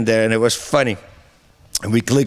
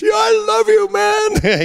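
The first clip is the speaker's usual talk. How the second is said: mock-tearful, much higher, choked-up "I love you"; imitating a crying voicemail; slower than usual. Friend on New Year's voicemail, weepy and much higher. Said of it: "crying voicemail message".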